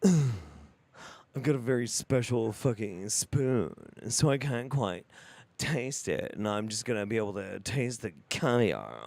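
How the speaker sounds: low, slow affected foodie voice; mocking metrosexual. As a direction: parody a precious, self-important gourmet. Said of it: "He even has this metrosexual".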